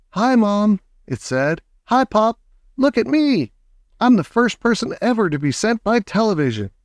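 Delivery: put-on high mouse-like boy; tiny squeaky voice. A narrator's reading. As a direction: pipe it up small and proud. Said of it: "a tiny little voice no louder than a squeak".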